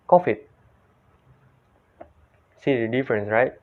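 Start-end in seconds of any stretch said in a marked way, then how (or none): 0.1-0.4 "covid" with an f, as "ko-fit"; short "covid", v hardened to f, higher pitched; Indonesian-style "covid" said "kofit"; Indonesian pronunciation. Shown as a demonstration. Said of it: "In Indonesia, they pronounce like".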